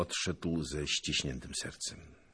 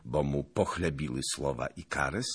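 ordinary delivery

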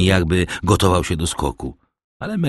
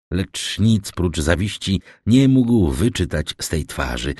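ordinary delivery

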